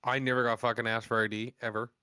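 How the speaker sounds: usual speaking voice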